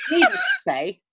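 high-pitched start, tickled by it; amused false start. Real, not acted. Recapping a failed plan to co-host, amused. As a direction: launch in high, on the edge of laughter. Breaks up, then restarts the phrase.

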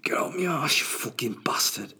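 high whispered strained curse; whispered curse of the straining man; straining man cursing. Retold or imitated. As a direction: hiss it through the strain. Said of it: "trying to exercise the shit out".

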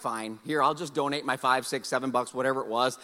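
low, soft, grudging surrender; quietly resigned father; retold resignation.

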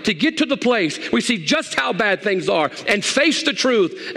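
high, urgent pulpit proclamation; full-force preaching. Real, not acted. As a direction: drive it home to the congregation, pitched high.

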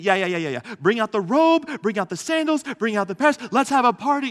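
gushing dad talking over his son, full of excitement; hammed-up eager father. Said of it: "doesn't even let the son finish".

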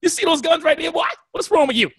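incredulous show-off; flexing comeback. Retold or imitated. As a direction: show off the guns, teasing. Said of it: "I'm like".

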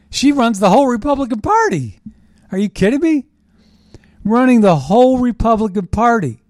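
you can't believe who runs the party; loud indignant disbelief at party leaders. Real, not acted.

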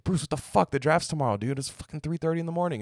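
high-pitched mock yell, fast at the end; exasperated GM yelling at Bruce, hammed up; imitating an angry GM. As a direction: play the furious GM pounding on the door at night. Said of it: "He's like".

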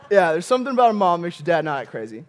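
rattled off fast as a knowing aside; fast, breezy repeat; quick rerun. Shown as a demonstration. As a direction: toss it off quickly. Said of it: "repeats line slower, then faster".

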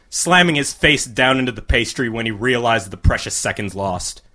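ordinary delivery

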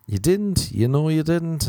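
high incredulous echo; mock disbelief. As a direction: act stunned she didn't say.

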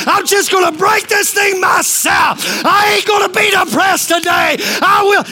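high, impassioned pulpit cry; testifying at full voice; full-force preaching. Real, not acted. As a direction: build to a shouted declaration against the devil.

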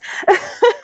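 breathy high giggle; laugh. Real, not acted. Guest giggling about almost being famous. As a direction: laugh it off lightly.

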